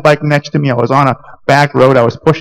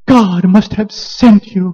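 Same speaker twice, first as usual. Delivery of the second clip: put-on awed girl; 7 semitones higher, slower than usual. Rescued girl looking up at him, slow and awed. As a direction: say it slowly in wonder like a young girl.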